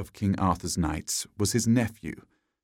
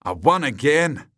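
loud, higher boast of victory; triumphant; 5 semitones higher, 5 dB louder than usual. A narrator's reading. Knight who has slain the dragon, crowing triumphantly. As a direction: crow over yet another win. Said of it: "shouted Sir Gawain triumphantly".

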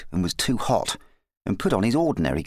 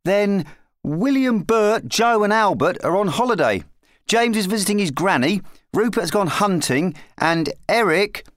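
put-on high-pitched boy's roll call; young lad; 10 semitones higher, 4 dB louder than usual. A narrator's reading. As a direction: count off names as a small boy. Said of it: "said Ralph, counting on his fingers".

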